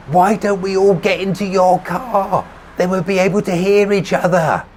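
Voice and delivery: high, sustained loud call; shouted suggestion. A narrator's reading. Narrator proposing they talk inside, shouting over traffic. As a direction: shout it across the car roof.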